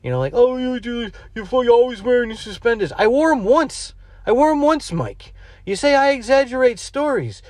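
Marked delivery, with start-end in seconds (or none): 0.3-2.9 high, louder mocking taunt; teasing friend voice. Mimicking Mike teasing him. 3.0-7.4 indignant protest. Real, not acted. Protesting to his friend Mike.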